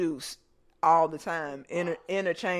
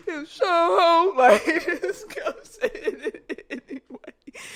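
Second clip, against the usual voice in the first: high, slow, laughing and squeaky; laughing through a quote; 12 semitones higher, 7 dB louder, slower than usual. Retold or imitated. Retelling the boy's cheek to a cousin, laughing hard. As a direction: crack up while repeating the boy's words.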